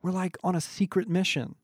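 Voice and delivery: high, conspiratorial delight; excited, smiling. A narrator's reading. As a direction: share it high, with a smile. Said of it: "she whispered, suddenly smiling".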